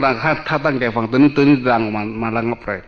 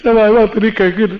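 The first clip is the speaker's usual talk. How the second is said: delighted exclaim; 9 semitones higher, 5 dB louder than usual. Acted. Exclaiming bliss of the state.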